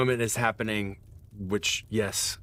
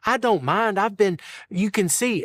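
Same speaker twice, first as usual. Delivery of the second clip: put-on much higher old woman's voice; sweet doting old wife; gentle old mama; 12 semitones higher, 5 dB louder than usual. Old wife to the party about her pallet, higher. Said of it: "she says".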